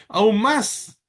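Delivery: ordinary delivery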